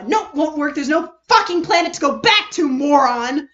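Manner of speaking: loud, angry written rant, rising to a yell; furious note. Acted. Kokichi's scrawled note read out as an angry yell. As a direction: spit the insult out at the end.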